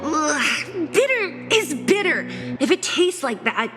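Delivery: whiny disgusted complaint; sulky, grimacing at the taste; bitter medicine. Acted. Complaining about bitter tea.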